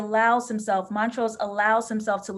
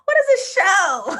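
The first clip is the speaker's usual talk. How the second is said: laughing cry; 10 semitones higher, 8 dB louder than usual. Real, not acted. Overwhelmed by the episode.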